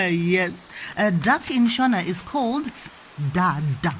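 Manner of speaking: ordinary delivery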